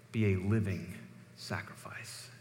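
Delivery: be a living sacrifice fading into an unvoiced whispered sacrifice; hushed emphasis. Real, not acted. Preacher to congregation, whispering sacrifice with hushed weight. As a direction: let sacrifice fall to a breath. Preacher drops voice on key phrase.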